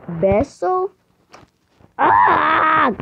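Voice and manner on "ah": high, loud yelp of disgust; short shocked shriek; outraged cry. Pretend. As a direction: squeal like you've just been kissed. Made to kiss a stranger.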